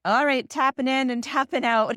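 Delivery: high, loud handover call. Acted. Couple swapping when one gets dysregulated, called out. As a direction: announce the switch like a tag team. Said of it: "we do a good, like".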